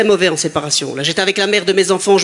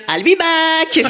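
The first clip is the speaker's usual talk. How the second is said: higher, small-voiced Terminator line; weak Terminator; 5 semitones higher, much slower than usual. Comedian with a weak voice imitating Schwarzenegger, higher. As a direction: deliver the threat with no menace. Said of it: "j'arrive et je te dis".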